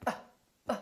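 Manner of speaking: "uh" as if punched in the stomach; a tiny little "uh" noise; very short, relaxed "uh". Shown as a demonstration. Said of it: "punch yourself in the stomach".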